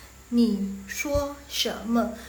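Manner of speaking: very slow, syllable-by-syllable Mandarin; slow model reading. Shown as a demonstration. Teacher models the Mandarin question, slow model reading. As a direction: separate each word of the question. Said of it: "Yang nomor lima".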